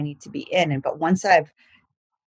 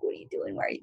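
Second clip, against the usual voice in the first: clingy; 12 dB quieter, faster than usual. Retold or imitated. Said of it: "a little bit more clingy".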